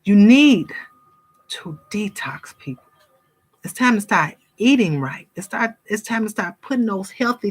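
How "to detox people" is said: whispered detox. Real, not acted. Preaching to viewers, suddenly whispering. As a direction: drop to a secret whisper for emphasis.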